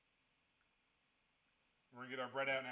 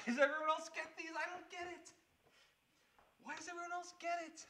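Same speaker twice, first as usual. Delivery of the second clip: put-on much higher, louder Australian-free childish whine; whiny kid voice; 5 dB louder than usual. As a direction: whine like a confused little kid. Whiny kid asking Mommy.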